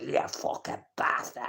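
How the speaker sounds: whispered, slow snarl like a wild dog; a hint of a raging father, growled under the breath; imitating angry dad. Mimicking his dad fuming about someone, whispered snarl. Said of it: "drooling out the mouth like a wild dog".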